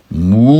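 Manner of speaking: single long moo, sliding up; drawn-out rising moo; cow moo. Acted. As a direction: answer with one cow call. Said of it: "had to say to that was".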